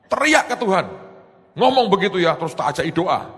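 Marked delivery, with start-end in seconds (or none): 0.1-2.4 high, pleading call to seek God; urgent urging. Retold or imitated. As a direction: urge him to cry out to Jesus. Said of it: "Saya bilang". (mendesak dengan nada tinggi)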